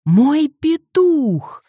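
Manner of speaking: very high, loud, drawn-out wail; wailing shout. A narrator's reading. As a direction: howl it in despair. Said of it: "вопль".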